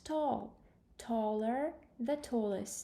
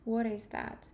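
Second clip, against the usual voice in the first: ordinary talk, nothing marked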